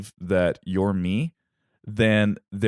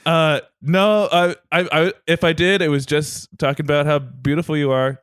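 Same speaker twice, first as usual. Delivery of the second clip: higher, louder stammering cover-up; flustered placating; 6 semitones higher, 3 dB louder than usual. Acted. Husband caught by Hera flatters her, flustered and raised. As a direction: backpedal nervously to an eavesdropping wife.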